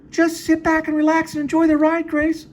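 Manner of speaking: very high falsetto woman voice; Jane's voice. Acted. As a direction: squeak it out high and sweet. Lady looks like Jane.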